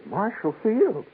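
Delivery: high, slow stunned echo; can't believe the name; amazed. Retold or imitated. Young man hears where he'll work, stunned and high.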